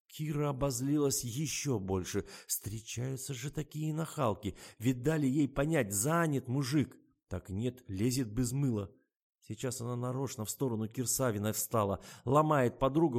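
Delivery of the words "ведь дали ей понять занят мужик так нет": usual speaking voice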